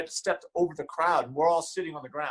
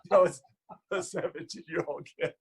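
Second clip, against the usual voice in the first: usual speaking voice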